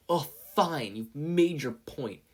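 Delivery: loud, exasperated, slow; grudging loud concession; irritated. A narrator's reading. Katsuki gives in irritably.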